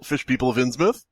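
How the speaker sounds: normal talking voice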